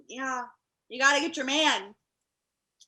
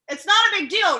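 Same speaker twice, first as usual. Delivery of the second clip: louder, higher, pressing the point; insistent; 6 semitones higher, 7 dB louder than usual. Real, not acted. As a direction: say it like you need them to agree. Insisting it's nothing.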